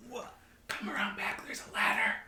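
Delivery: hushed whisper from the roof. Acted. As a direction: whisper it so no one hears.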